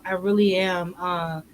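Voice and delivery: ordinary delivery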